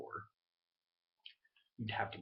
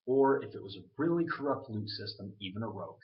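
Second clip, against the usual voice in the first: ordinary delivery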